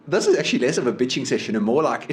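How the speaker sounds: ordinary talk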